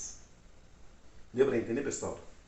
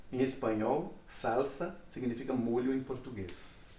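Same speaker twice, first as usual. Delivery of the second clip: usual speaking voice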